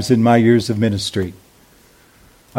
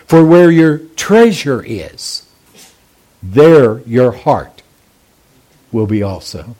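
scripture recitation; 2 semitones lower than usual. Acted. Reciting memory verse.

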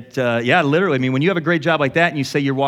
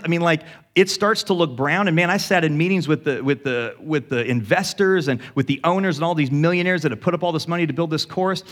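ordinary delivery

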